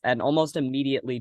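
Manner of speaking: ordinary talk, nothing marked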